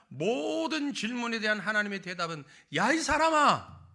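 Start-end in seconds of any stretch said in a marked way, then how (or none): 2.7-3.9 much higher, louder, slow rebuke; scolding. Acted. God answering every complaint, stern scolding. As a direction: thunder the rebuke like God scolding his creature. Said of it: "꾸짖는 말입니다".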